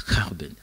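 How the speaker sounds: Gerben with a throaty, rasped Dutch G; Dutch pronunciation. Shown as a demonstration. Preacher attempting a hard Dutch name, guttural pronunciation. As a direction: say "Gerben" with a guttural first sound. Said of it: "c'est pas facile de prononcer".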